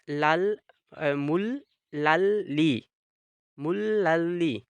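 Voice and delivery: मुल लल ली, syllable by syllable, very slowly; slow Korean pronunciation drill. Shown as a demonstration. Teacher slowly drills students on मुल लल ली. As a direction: spell out मुल लल ली slowly with a doubled l. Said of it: "तो इसको मुन नन री को पढ़ेंगे".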